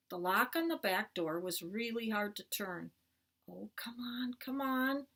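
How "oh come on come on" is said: hushed, urgent coaxing under the breath; whispered plea. A narrator's reading. Boy coaxing a stuck back-door lock, whispered plea. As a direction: whisper it, willing it to move. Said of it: "I whispered".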